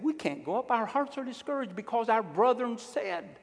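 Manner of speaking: high-pitched protest of a disheartened people; complaining Israelites. Acted. Israelites answering Joshua's call to go up, in high-pitched complaint.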